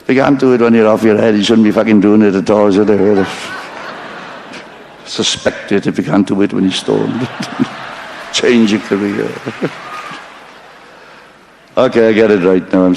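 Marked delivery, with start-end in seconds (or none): none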